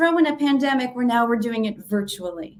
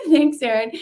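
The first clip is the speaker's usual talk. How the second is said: laughing thanks; 6 semitones higher, 4 dB louder than usual. Real, not acted. Laughs while thanking Erin.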